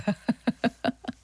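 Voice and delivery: rapid staccato laugh; real laughter. Real, not acted. DJ tickled by how silly the socks look, laughing. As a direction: break into giggly laughter. Laughing at absurd sock photo.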